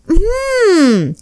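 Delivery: thinking it over. Acted. May sniffs a cup to guess the food, pondering. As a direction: sniff and puzzle before guessing.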